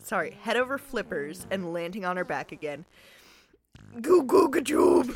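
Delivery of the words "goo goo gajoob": loud, high wild bellow. Acted. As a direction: bellow it like a crazed walrus. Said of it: "The walrus bellowed wildly".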